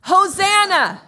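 Hosanna drawn out, loud and high; full shout. Shown as a demonstration. Pastor leading the congregation in a shout, long loud Hosanna. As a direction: shout it from the top of your lungs. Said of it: "ready? One, two, three".

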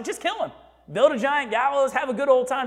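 high-pitched mock wife voice; light, lilting voice pitched well up; Zeresh. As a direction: imitate a wife breezily giving ruthless advice. Voicing Zeresh advising Haman.

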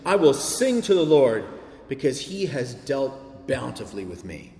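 solemn, measured Bible reading; scripture reading. Acted. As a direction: read the psalm as a heartfelt lament.

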